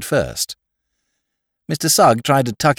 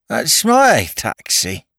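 put-on drunken dignity; 3 semitones higher, 4 dB louder, much slower than usual. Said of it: "interposed his lordship with dignity".

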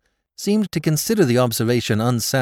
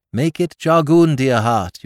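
a bright, louder, higher mock cry; joyful cry; 5 semitones higher, 6 dB louder, slower than usual. A narrator's reading. Jimmy mocking the knight's joyful cry to his wife. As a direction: gush it out like a delighted husband. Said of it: "you cried joyfully".

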